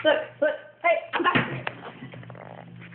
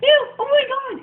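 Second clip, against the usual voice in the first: high loud grossed-out ew; disgusted; 9 semitones higher, 7 dB louder than usual. Real, not acted. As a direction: recoil at something gross. Disgust at jelly-like peanut butter.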